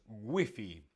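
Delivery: "wifi" said as WEE-fee; Spanish pronunciation. Shown as a demonstration. Teacher telling viewers how Spain says it, Spanish pronunciation. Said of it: "En España decimos".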